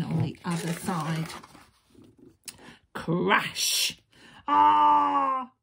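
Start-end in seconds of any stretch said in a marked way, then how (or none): 2.9-4.0 loud, drawn-out crash; crashing sound effect. A narrator's reading. Giant falls into the hole. 4.5-5.5 long, high, loud "aah"; giant's cry. A narrator's reading. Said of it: "cried the giant".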